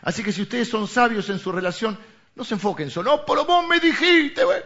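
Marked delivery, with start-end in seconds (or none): 3.2-4.6 high, loud whiny accusation; mock reproach. Pastor mimics a partner fixated on words, high mock reproach. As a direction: protest in a raised, exaggerated voice. Acted couple's reproach to each other.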